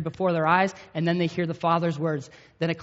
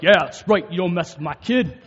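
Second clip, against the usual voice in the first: mock tough warning; 8 dB louder than usual. Dad warns off boys.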